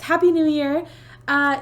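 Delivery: normal talking voice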